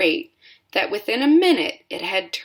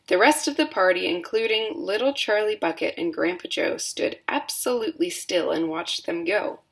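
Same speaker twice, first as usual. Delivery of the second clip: usual speaking voice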